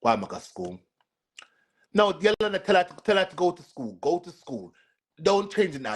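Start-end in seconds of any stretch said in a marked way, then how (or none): 1.9-5.9 scolding. Real, not acted. Telling off a viewer, high and loud.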